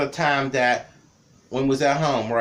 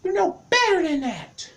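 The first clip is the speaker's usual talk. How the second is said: high falsetto imitation of an angry mom; upset mama. Mama scolding her kid over the lighter, high falsetto mock scold. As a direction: squeak it out like a scolding mother. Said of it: "she got upset with me".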